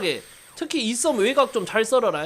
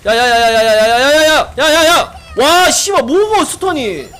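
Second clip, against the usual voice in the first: loud rapid-fire yells rising in pitch; real alarmed shouting; 3 semitones higher, 9 dB louder than usual. Real, not acted. Gamer hit by a sudden stun, real alarmed shouting. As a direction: yell out in shock, then curse loudly.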